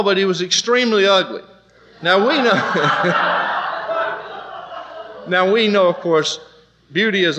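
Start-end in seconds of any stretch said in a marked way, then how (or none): 2.0-5.3 laughing. Real, not acted.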